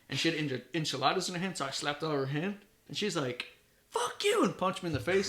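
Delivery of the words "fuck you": high-pitched imitation of an angry sister; mock angry curse. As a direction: squeal the curse as the furious sister. Sister's fight retold, acted quote.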